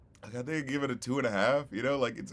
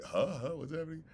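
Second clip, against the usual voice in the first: higher, softer puzzled query; confused reaction; 7 semitones higher, 5 dB quieter than usual. Acted. Confused listener reacting to a whirlwind song, puzzled. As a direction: lightly act out being lost. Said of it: "it was just a little like".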